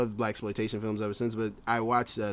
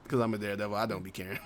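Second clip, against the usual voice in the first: ordinary talk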